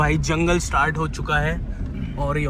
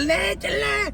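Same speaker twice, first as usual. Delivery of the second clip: mock shout; 14 semitones higher, much slower than usual. Real, not acted. Mocking shout at friends.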